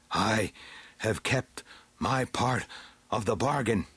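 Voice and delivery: ordinary delivery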